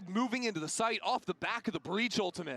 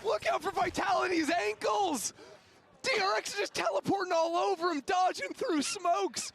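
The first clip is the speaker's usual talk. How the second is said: man down shout; 13 semitones higher than usual. Pretend. Mock battlefield calls over chaos.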